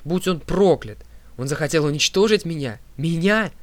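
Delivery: loud, high-pitched curse; indignant, outraged shouting; furious outburst. A narrator's reading. Furious outburst at her father.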